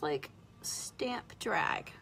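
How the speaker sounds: breathy whisper, slow and careful; whispering while focused on the eyeliner; whispered while concentrating. Real, not acted. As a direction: whisper it while drawing the line.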